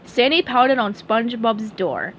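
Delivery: plain reading voice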